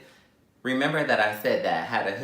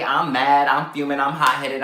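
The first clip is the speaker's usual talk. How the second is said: ordinary talk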